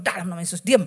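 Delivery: low-pitched mock command to be silent; retold stern rebuke. Preacher retelling how she silenced the inner voice, stern rebuke. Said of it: "tak tengking terus itu dalam hati".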